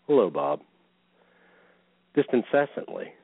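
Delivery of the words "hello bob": lower, lightly voiced Zane greeting; imitating Zane. Bob mimics Zane's endless greeting, lower mock voice. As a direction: drop the pitch to mimic his greeting. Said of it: "he'd say".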